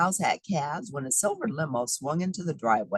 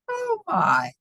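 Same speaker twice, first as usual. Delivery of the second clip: mock amazement. Ring found in box.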